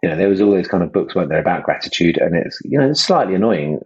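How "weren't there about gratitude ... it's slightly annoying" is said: ordinary talk, nothing marked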